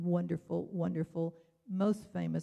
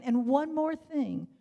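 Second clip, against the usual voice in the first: pressing on; 7 semitones higher, 6 dB louder, slower than usual. Real, not acted. Rushes on after the time limit.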